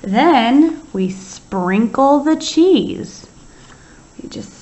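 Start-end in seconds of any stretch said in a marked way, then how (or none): 0.0-3.3 very slow, lifted dictation; spell-along pace. Shown as a demonstration. Teacher dictating the next sentence to the class. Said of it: "Then what happens?".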